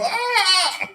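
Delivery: high startled yelp, hammed-up; high hammed-up falling cry; mock yelp. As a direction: squeal as if knocked over.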